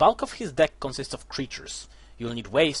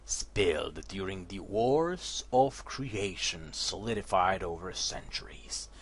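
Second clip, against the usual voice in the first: story narration; 5 semitones lower, 6 dB quieter than usual. A narrator's reading. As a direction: read it like a dark fantasy tale.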